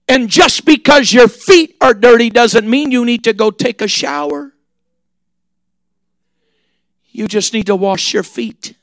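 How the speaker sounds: soft, hushed, gentle sermon close; quiet tender preaching. Acted. Preacher softly telling the congregation to just wash their feet.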